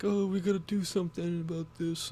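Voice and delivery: high, loud mock blubbering; hammed-up imitation of a man crying. Mocking a former director crying on TV, fake sobbing. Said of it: "he just started breaking out and crying".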